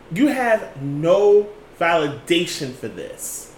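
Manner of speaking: measured but heated telling-off; indignant condemnation. Real, not acted. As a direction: spell it out slowly, in outrage. Condemns the officers' conduct.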